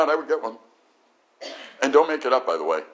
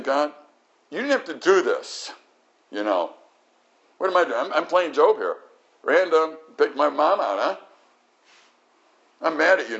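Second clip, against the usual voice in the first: raised, higher mock-angry plea to God; retold angry prayer; 6 semitones higher, 4 dB louder than usual. Preacher retelling anger at God behind the ambulance, raised voice. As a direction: reenact shouting at God, a bit louder. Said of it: "I'm one angry person".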